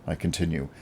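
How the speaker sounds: ordinary delivery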